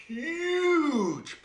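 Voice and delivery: huge stretched long and high; stress on huge. A narrator's reading. Storyteller showing the size of the straw pile, huge drawn out. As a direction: draw out "huge" in a high, swooping voice.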